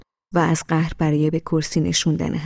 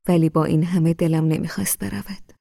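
ordinary delivery, nothing marked

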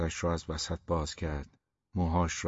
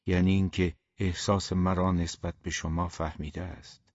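usual speaking voice

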